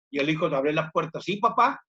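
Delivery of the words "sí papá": son's voice. Acted. Son answers father in story.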